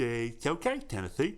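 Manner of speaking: lisping cartoon walrus voice; put-on walrus character voice; Chumley. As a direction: do a dopey cartoon walrus sidekick. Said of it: "most spot on Chumley impression".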